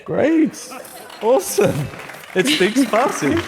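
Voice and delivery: real thrill at a demo that worked, pitched high; high excited cheer as the translation works; excited delight. Real, not acted. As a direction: burst out with high, delighted relief. Live Farsi demo finally succeeds.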